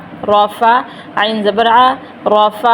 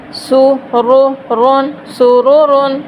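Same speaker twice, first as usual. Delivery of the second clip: slow, drawn out; full word with tanween; 2 semitones higher, much slower than usual. Shown as a demonstration. Teacher reads سرورن slowly with full ending. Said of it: "وقف کیسے کرنا".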